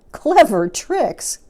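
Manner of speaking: high, loud, slow incredulous cry; cried in disbelief. Acted. Small croc crying out in disbelief. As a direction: shriek the words back at him. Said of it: "cried the not-so-big one".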